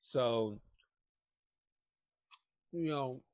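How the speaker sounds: talking while chewing; words around a bite of food; mouth full. Real, not acted. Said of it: "stop eating and talking".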